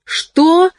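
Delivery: sharp high cry of horror; horrified cry. A narrator's reading. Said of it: "в ужасе вскрикнула Лёля".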